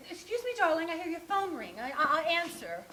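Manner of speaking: acted, hurried offer to answer; imagined husband. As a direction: interrupt as the husband coming home. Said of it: "he came home right at that moment".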